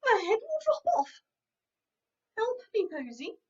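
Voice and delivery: high, loud sobbing plea; crying cow. Acted. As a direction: wail for help through tears. Cow cries.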